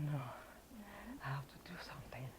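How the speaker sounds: whispered to herself, fast; whispered resolve. Retold or imitated. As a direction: whisper a private vow. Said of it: "So that time I said".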